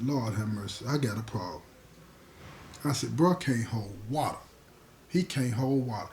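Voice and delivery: low, soft and subdued; dejected self-talk. Retold or imitated. Storyteller alone on the bunk realizes he has a problem, dejected. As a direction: sink into quiet, troubled musing. Reenacts worried muttering to himself alone.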